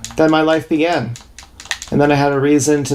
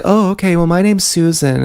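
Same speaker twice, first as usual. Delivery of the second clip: put-on higher-pitched voice of an unfazed girl; imitated girl; 4 semitones higher than usual. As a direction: lightly mimic a girl introducing herself. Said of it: "this girl's like".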